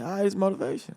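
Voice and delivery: voiced thought, pitched well up; upbeat self-talk. Acting out own past thought.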